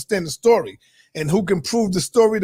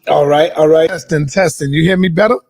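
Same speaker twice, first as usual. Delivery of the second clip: loud mic test; 3 semitones lower, 7 dB louder than usual. Real, not acted. Mic check, too loud.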